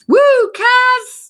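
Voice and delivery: high, loud whoop; excited cheer. Real, not acted. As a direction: whoop for them in delight. Cheering a viewer on the stream.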